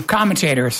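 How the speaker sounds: "commentators" said with a South African lilt; a South African commentator's "commentators"; South African accent. Shown as a demonstration. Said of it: "That's a very South African accent".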